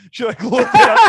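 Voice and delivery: high, loud laugh through the words. Real, not acted. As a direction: crack up mid-sentence. Laughing while retelling the glance.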